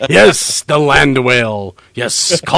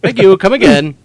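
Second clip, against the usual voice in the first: mock Apu accent; 4 semitones higher, 5 dB louder than usual. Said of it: "selling slushies with Apu".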